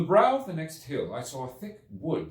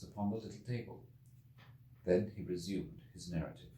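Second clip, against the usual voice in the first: softer, hushed frame narration; hushed aside; 8 dB quieter than usual. A narrator's reading. Narrator quietly describing the traveller placing flowers, hushed. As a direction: drop the voice for the outer narrator's aside.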